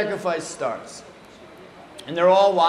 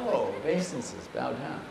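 low, soft dawning understanding; quiet realisation; 5 semitones lower, 8 dB quieter than usual. Retold or imitated. Initiates finally understanding the guru's order, low and soft. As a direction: drop it right down as the penny drops.

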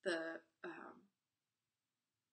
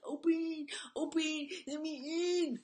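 higher, louder pleading; pleading shout; 9 semitones higher, 9 dB louder, slower than usual. Retold or imitated. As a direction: beg them to open up. Parent pleading closed kids.

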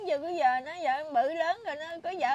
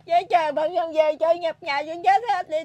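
crying out loud, voice breaking with grief; louder wails through the tears; real sobbing; 3 semitones higher, 11 dB louder than usual. Real, not acted. Grandmother pouring out despair to the interviewer, sobbing loudly. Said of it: "Buồn lắm chú ơi".

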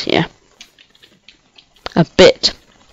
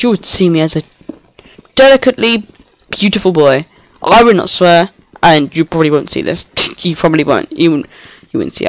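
normal talking voice

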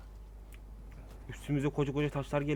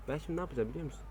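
ordinary delivery

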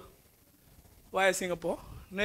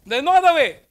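emphatic declaration; 4 semitones higher, 7 dB louder, faster than usual. Real, not acted. Lecturer hammering home key point.

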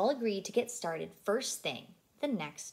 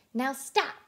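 mild shout; 5 semitones higher, 5 dB louder than usual. A narrator's reading. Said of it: "Blue shouted".

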